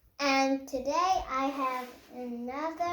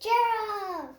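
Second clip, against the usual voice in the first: high, bright call of a friend's name; 6 semitones higher than usual. Acted. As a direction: call Gerald from across the way. Piggy calls out to Gerald.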